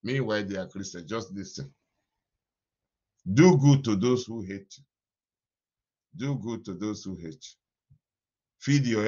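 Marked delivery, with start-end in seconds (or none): 3.3-4.8 much louder, called out to the congregation; booming proclamation. Acted. Pastor charging the congregation, booming proclamation. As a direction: proclaim it like a pulpit command. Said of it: "preacher proclaims the command".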